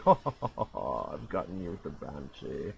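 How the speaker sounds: gloating enemy. Retold or imitated. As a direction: gloat like a cartoon villain. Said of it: "He's like".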